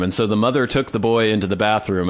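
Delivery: usual speaking voice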